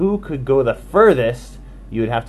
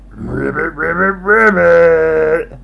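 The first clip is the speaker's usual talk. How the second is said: slow, louder croaking ribbits; frog croak; 5 semitones higher, 7 dB louder than usual. Acted. Frank the Frog answers with slow croaking ribbits.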